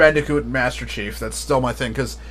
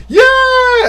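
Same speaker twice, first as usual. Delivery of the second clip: high, loud, drawn-out mock cheer; imitated fan cheering; 6 dB louder than usual. Streamer imitating US players at Banjo's announcement, loud cheering. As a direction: holler like a thrilled crowd.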